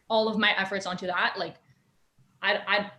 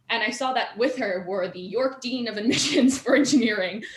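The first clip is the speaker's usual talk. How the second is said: normal talking voice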